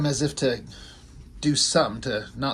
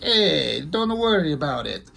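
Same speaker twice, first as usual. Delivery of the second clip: put-on light Italian accent, like Mario; a hint of a Mario-style Italian; lightly put-on Italian voice; 2 semitones higher, slower than usual. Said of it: "I make him sound Italian".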